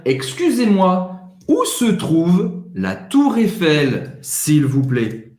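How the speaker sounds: drawn out, careful French; "Excusez-moi, où se trouve la Tour Eiffel" said very slowly; slow model phrase. Shown as a demonstration. Teacher models asking directions in the street, slowly. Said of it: "Uma pergunta pode ser".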